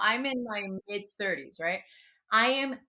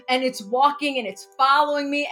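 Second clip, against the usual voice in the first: ordinary talk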